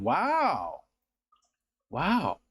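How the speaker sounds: drawn-out, swooping "wow"; amateur celebrity catchphrase impression; Owen Wilson. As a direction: stretch the wow, pitch gliding up and down. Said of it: "That was my Owen Wilson".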